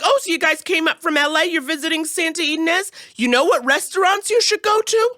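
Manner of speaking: imitating a cop desperate to be pals; overfriendly officer. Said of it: "trying to be like, It's okay, we're friends".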